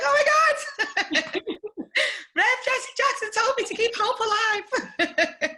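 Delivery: thrilled, laughing, very high and loud; excited. Retold or imitated. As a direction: squeal it out, laughing with delight. Retelling thrilled reaction, laughing.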